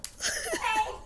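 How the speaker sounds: high breathy laugh; squeaky laugh. Real, not acted. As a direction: let out a high giggle.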